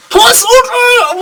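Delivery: 模仿德库的高声呐喊; battle cry shout. Retold or imitated. Imitating Deku's battle cry.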